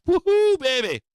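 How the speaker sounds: loud high whoop of joy; whooping cheer. Retold or imitated. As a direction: cheer like you just hit it big. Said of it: "now I'm like".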